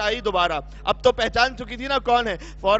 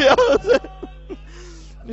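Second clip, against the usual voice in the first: drawn-out, much slower wail of یا حسین breaking into weeping; wailing cry; 10 semitones higher, much slower than usual. Acted. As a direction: stretch یا حسین into a slow sobbing wail. Woman calls out before Zainab. (یا حسین کو بہت آہستہ روتے ہوئے کھینچ کر)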